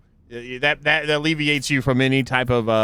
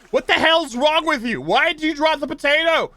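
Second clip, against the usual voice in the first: mock outrage; 12 semitones higher than usual. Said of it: "then they're like".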